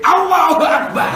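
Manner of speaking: high, drawn-out shouted invocation; mock bellow. Imitating a loud Maulid celebrant, mock bellow. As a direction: bellow it slowly at the top of the range.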